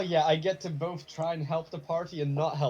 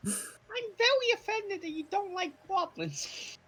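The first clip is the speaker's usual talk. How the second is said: squeaky, much higher, slow goblin; slower than usual. Acted. Goblin character answers offended.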